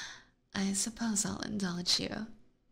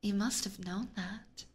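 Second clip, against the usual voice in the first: normal talking voice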